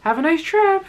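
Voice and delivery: high, loud sing-out of a send-off; mock high-pitched send-off; mimicked officer.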